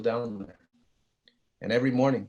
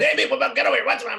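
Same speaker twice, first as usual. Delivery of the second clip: much higher, louder booming dad voice with a laugh; loud talking dad; 13 semitones higher, 6 dB louder, faster than usual. Retold or imitated. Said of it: "I had a loud talking dad".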